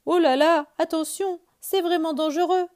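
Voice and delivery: high, loud anxious warning; alarmed bear. Acted. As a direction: warn him, it's dangerous. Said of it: "dit Gros Ours".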